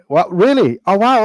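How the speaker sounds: high, loud burst of amazement; surprised. Real, not acted. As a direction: react with real surprise, pitch leaping up. Learns the build was auctioned.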